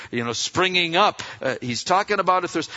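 ordinary talk

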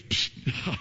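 a sly unvoiced psst; whispered psst. The enemy getting the believer's ear, a sly psst. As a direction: hiss for attention like a tempter. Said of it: "And the enemy goes".

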